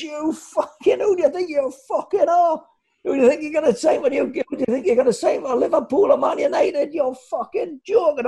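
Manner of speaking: imitating a manager's high-pitched furious tirade; absolutely raging. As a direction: rant down the phone, pitch way up, dragging out the last insult. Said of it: "he was absolutely raging on the phone".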